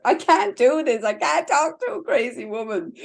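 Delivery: lightly voiced flustered husband; exasperated husband. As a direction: play the fed-up husband throwing his hands up.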